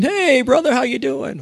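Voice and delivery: put-on high-pitched, overfriendly drunk greeting; imitated tipsy brother. Drinking brother suddenly greeting sibling, mock tipsy friendliness. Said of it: "when we drinking".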